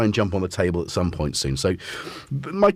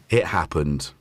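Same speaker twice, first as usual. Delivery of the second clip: ordinary delivery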